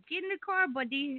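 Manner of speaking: high, soft mock come-on; stranger's invite. Stranger in car urges him, high mock coax. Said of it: "He said".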